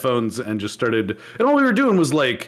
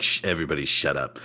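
much lower and softer, a hushing put-down; mock hushing; 12 semitones lower, 6 dB quieter, faster than usual. Imitating the retiring coworker hushing the office, low and soft. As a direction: shush them flatly, deep and quiet. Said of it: "she just kind of was like".

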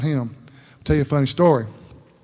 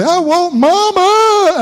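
put-on high, slow whiny child asking for mama; little boy voice; 14 semitones higher, 5 dB louder, much slower than usual. Father recalls his little son whining for mama.

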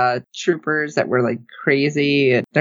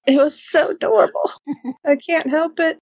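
much higher, gushing Southern belle lilt; 10 semitones higher than usual. Real, not acted. Gushing to the hosts about Baby Grogu touching Mando's face, in a Southern belle voice. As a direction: swoon over it like a Southern belle. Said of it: "suddenly went super Southern Belle there".

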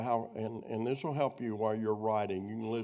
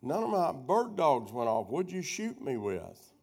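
calling back; 4 semitones higher, 3 dB louder, slower than usual. Retold or imitated. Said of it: "I called back to him".

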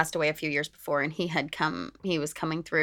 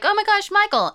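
high, louder mock fan squeal; gushing fan; 5 dB louder than usual. Convention-goer recognizing Michael, gushing. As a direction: imitate an excited fan spotting a favorite.

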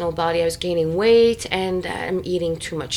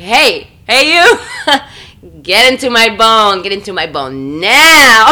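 much louder, calling out to God, laughing through it; gospel call; 13 dB louder than usual. Retold or imitated. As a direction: belt it like a gospel preacher. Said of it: "The way he talks to the higher power, he's like".

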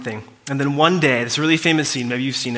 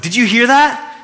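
higher and louder, startled and urgent; freaked out; 10 semitones higher, 8 dB louder than usual. Retold or imitated. Ray shouts to his wife on the porch, rattled. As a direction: call across to her, rattled.

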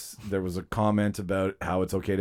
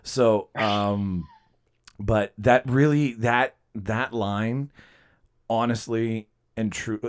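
ordinary talk, nothing marked

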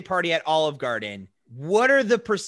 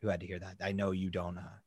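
low, hushed apology; mock-solemn apology; 11 semitones lower, 6 dB quieter than usual. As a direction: drop right down into a grave, soft apology. Mock-formal apology for the accent.

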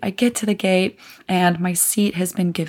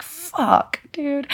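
whispered, high curse of realisation; mock whispered curse; 8 semitones higher than usual. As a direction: hiss it under your breath, caught out. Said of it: "And I was like".

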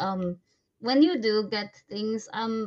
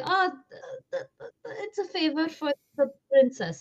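higher, lightly hammed-up stutter; mock stammering messenger; 8 semitones higher than usual. Player acting out the message for the princess, mock stammer. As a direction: stumble over the words in a mock-flustered high voice.

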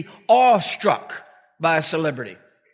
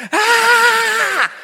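high, loud held "ah" scream; mock scream; 14 semitones higher, 6 dB louder than usual. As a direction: imitate a startled fan's scream. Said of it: "She just screamed".

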